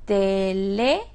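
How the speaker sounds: syllable by syllable. Shown as a demonstration. Teacher repeating the solved example for students, syllable by syllable. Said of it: "En la antepenúltima".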